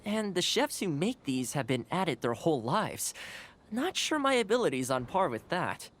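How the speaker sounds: usual speaking voice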